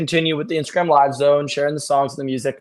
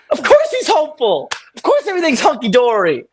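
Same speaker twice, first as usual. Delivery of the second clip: high-pitched incredulous comic rant; mock exasperation; 11 semitones higher than usual. Ranting about Superman's easy hope, mock exasperated. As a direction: exclaim it as if it's absurdly obvious.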